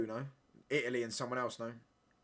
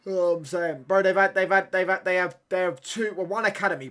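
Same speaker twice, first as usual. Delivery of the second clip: loud, higher exasperated rant; heated rant; 6 semitones higher, 10 dB louder than usual. Real, not acted. Fan ranting about the opponent's young defenders. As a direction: vent at the screen in frustration.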